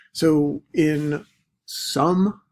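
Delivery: some drawn out slowly, rising; slow emphatic some; stress on some. Shown as a demonstration. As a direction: lean hard on the word some, very slow. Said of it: "I'm emphasizing the word some".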